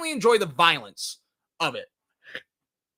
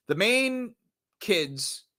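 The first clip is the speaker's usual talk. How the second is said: ordinary talk, nothing marked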